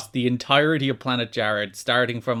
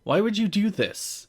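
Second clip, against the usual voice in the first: acted high, indignant demand; angry confrontation; 9 semitones higher than usual. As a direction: demand an answer, mock anger. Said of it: "very angry".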